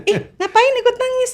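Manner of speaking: very high, amused incredulous question; retold surprise. Pilgrim asks the stranger why he cried too, retold surprise. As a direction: squeal the question in mock astonishment.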